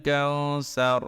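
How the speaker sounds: slow, drawn-out chanted recitation; Quran recitation. Shown as a demonstration. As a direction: recite with long melodic vowels. Recites verse slowly.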